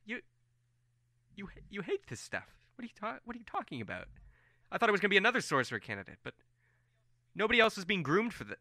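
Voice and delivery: high, fast, tumbling protest; rushed disbelief. Acted. Colm confronting Farron, words spilling out high and fast. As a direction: rattle it off in shocked disbelief.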